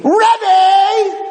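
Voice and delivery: high, loud shout across a crowd; shouted call. Retold or imitated. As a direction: call out her old teacher's name from behind. Girl calling out from behind.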